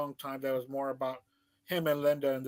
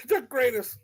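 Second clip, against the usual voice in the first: laughing; 9 semitones higher, 5 dB louder than usual. Real, not acted. Laughing repeat of friend's claim.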